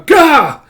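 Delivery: loud, high "gah" cry; pained yell. Acted. Storyteller as Zin being chomped by the hound, pained yell. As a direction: cry out on impact.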